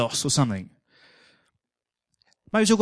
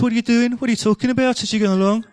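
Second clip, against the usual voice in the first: put-on a light Brummie accent, a touch higher; Birmingham accent; 4 semitones higher than usual. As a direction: greet the lads in a lightly voiced Brummie twang. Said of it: "Maybe you put an accent on".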